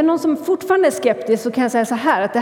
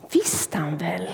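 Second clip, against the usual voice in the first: whispered aside; 5 semitones lower, 7 dB quieter than usual. A narrator's reading.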